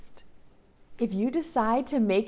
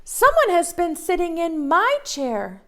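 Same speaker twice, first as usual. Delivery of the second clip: mama bear; 7 semitones higher than usual. Acted. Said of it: "said Mama Bear".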